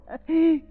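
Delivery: short, loud sinister laugh; a villain's triumphant bark of laughter; evil laugh. Acted. Villain gloats.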